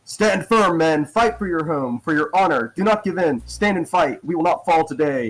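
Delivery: loud rallying cry to the troops; booming rousing general speech. Acted. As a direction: bellow it like a general rallying his men. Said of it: "Lee will call out".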